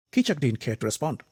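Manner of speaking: ordinary delivery, nothing marked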